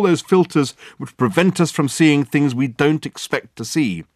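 loud, high exasperated lecture; frustrated outburst. Acted. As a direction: hammer the point in frustration. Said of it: "thumping the table in frustration".

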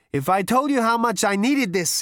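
high, loud hungry eagerness; ravenous Zaphod. Acted. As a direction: sound starving for it. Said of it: "he said ravenously".

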